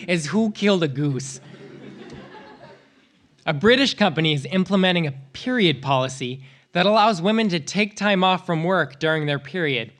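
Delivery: ordinary delivery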